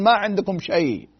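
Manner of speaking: ordinary talk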